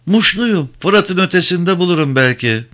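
cling to a small hope; old mother telling the ferryman where she is from; hopeful old woman. Acted. Old mother answers, hoping.

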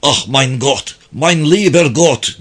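mildly anguished German cry, slowing on the plea; broken, pitiful voice. Acted. Wounded German calling to God in pain, broken and pitiful. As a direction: plead brokenly, lifting into the second appeal. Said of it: "İnsanı acındıran kırık bir sesti".

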